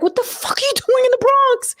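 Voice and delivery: high, incredulous squeal; shocked question. Retold or imitated. She recalls spotting her ex at the precinct, stunned and high. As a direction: ask it in stunned disbelief. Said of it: "So I'm like".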